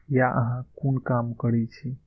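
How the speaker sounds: ordinary delivery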